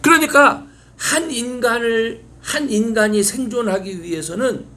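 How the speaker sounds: high, loud pulpit voice; full-force sermon climax; fervent preaching. Acted. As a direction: proclaim it to the congregation.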